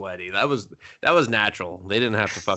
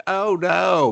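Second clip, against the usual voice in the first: feigned alarm; 7 semitones higher than usual. Retold or imitated. Voices her feigned alarm at dock.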